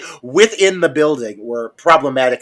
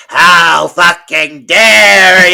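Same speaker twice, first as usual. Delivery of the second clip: slow, drawn-out, louder indignant line; mock outrage; 4 semitones higher, 9 dB louder, much slower than usual. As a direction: stretch every word of the insult. Retells May's indignant line.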